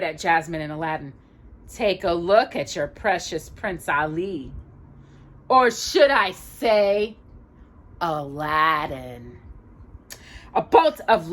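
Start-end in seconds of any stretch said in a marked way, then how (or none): 1.7-9.4 slow, sly sneering reveal; mocking villain. Jafar unmasks Prince Ali, slow sly sneer. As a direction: drawl the name to expose him.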